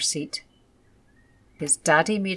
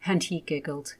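ordinary delivery, nothing marked

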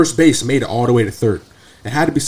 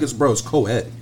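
ordinary talk

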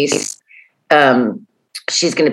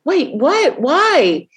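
surprised; 11 semitones higher, much slower than usual. Retold or imitated. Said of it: "And I'm like".

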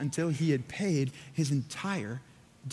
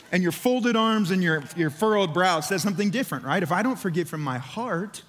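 ordinary talk

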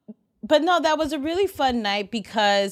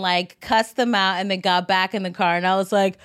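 ordinary delivery

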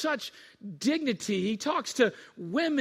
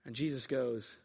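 normal talking voice

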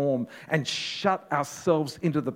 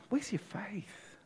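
whispered rebuke; 7 semitones higher, 9 dB quieter, slower than usual. Acted. Jesus rebukes the disciples.

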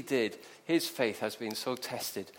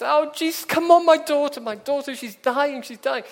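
high, loud, frantic begging; desperate plea; 12 semitones higher, 12 dB louder than usual. Acted. Desperate father begging Jesus to come to his dying daughter, frantic. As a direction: beg urgently, pitched high and pressing.

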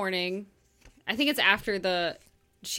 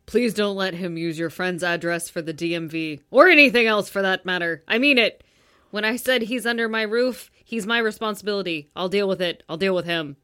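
loud, forceful book hero; man's character voice; 7 dB louder than usual. Acted. Host reads the stern hero's lines, commanding. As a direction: lay down the law, firmly and loudly. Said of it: "Yeah, do the voices".

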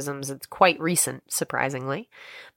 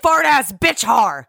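angry caller; 6 semitones higher, 5 dB louder, slower than usual. Pretend. Caller cursing back.

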